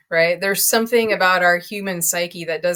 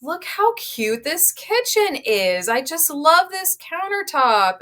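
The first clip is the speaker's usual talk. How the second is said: higher and slower, gushing over the kitchen; enthusiastic agent; 8 semitones higher, slower than usual. Retold or imitated. Agent showing buyers a kitchen, delighted and lively. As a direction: sell it like you love it. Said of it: "if an agent brings you through a house and you say".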